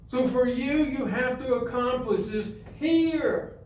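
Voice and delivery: forceful preaching. Real, not acted. Telling listeners they must lift themselves up, forceful preaching. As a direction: drive it home to the whole room.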